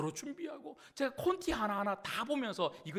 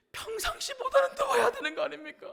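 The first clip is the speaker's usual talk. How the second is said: very high, loud complaint in prayer; re-enacted tearful plea; 14 semitones higher, 9 dB louder than usual. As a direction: protest to God as if in tears. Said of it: "막 울면서". (울먹이며 따지듯 기도하는 재연)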